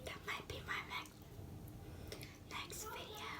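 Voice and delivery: secretive whisper. Real, not acted. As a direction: whisper a hint to the viewers. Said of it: "Just giving you a little hint".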